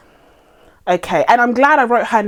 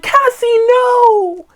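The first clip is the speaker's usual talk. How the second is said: high, drawn-out mock cry; overacted father calling to his daughter; Paul Rudd; 14 semitones higher, 5 dB louder, much slower than usual. Reviewer mimicking the actor answering his screen daughter, mock cry. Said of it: "And Paul Rudd was like".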